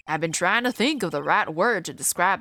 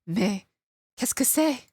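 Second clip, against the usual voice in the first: lightly hammed-up fake French accent; terrible French accent; 4 semitones higher than usual. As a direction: play the nervous Frenchman with a bad accent. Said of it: "I love doing terrible French accents".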